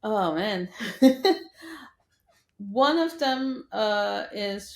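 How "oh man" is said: ordinary delivery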